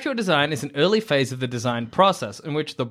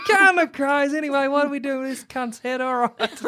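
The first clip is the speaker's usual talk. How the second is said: high, rowdy footy-fan holler, played for laughs; mock belligerent, pitched way up; aggressive surgeon; 7 semitones higher than usual. Surgeon cheering his team mid-operation, mock yelling. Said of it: "That's terrifying".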